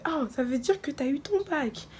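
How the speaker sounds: put-on high curious child voice; curious kid. Imitating a twelve-year-old boy in the park, high and curious. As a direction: lift it up like an eager little boy.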